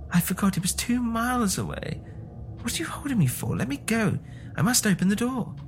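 high, pleading mother; frantic old woman. A narrator's reading. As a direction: beg to be let go. Mother struggling to reach son.

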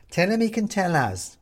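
high, light Mum voice; lightly voiced mother; Mum's voice. A narrator's reading. Mum adds from mantelpiece.

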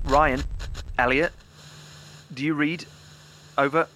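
radio call. Acted. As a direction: call them over the handset, loud and clear. Calling partners over the radio.

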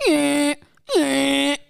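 high koala bleat; short, high "eh" like a dying koala; imitated dying koala. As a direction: mimic a koala's cry. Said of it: "sounds like the koala's dying".

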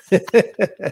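laughs the word out, high and loud; bursting into laughter mid-word; laughing. Real, not acted. Laughing at own beating story.

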